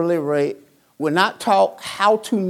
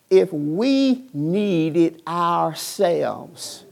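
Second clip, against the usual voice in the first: slowed down; 2 semitones lower, much slower than usual. Real, not acted. Slowed preaching close.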